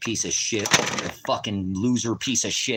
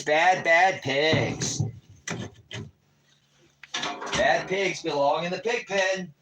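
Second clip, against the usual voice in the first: higher, slow taunting voice, as if scolding animals; mocking singsong; 8 semitones higher, slower than usual. Streamer taunting cops in his chat, higher mocking singsong. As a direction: drag out "Bad, bad pigs" like scolding livestock.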